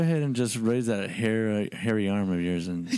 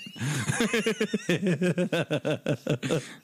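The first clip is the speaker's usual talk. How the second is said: laugh; 6 semitones higher than usual. Real, not acted. Laughing at own line.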